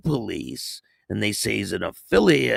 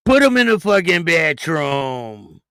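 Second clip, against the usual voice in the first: louder, higher imitation of the clip's shout; mock clip quote; 7 semitones higher, 7 dB louder than usual. Host echoing the clip line for chat, hammed-up loud imitation. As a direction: echo the clip like a hammed-up yell.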